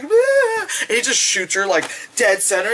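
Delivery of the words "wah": shrill-pitched hammed-up war cry, much higher; mock machete charge shriek, very high; high mock battle shriek. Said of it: "she's like".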